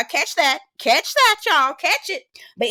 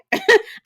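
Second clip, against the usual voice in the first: high amused laugh; 4 dB louder than usual. Real, not acted. Vlogger laughing about catching the shade, high and amused.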